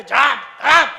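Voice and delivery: ordinary talk, nothing marked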